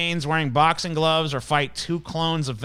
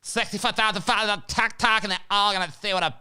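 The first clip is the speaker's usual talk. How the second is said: mock smug TikTok woman; 4 semitones higher than usual. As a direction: parody her bragging about followers. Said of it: "she's like".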